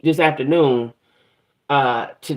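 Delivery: ordinary delivery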